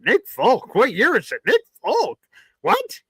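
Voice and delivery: climbing to very high incredulous squeaks; reacting to the Nick Folk signing with rising high-pitched disbelief; mock disbelief. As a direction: act out hearing absurd news. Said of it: "And I'm like".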